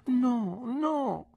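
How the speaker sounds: put-on very high girlish refusal; Dora protesting. A narrator's reading. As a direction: refuse like a frightened little girl. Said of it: "disse Dora". (rifiuto con voce altissima)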